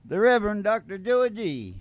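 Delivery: usual speaking voice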